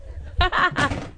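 high breathy giggle bursting out; real laugh. Real, not acted. Reader laughing at her Maury Povich joke, real giggle. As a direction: laugh at your own joke.

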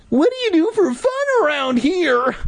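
very high, squeaky put-on kid; child hero voice. Hero asking Louisa about fun, squeaky kid voice. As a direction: pitch it way up for the young hero.

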